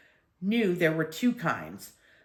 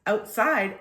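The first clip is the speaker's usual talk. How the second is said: ordinary delivery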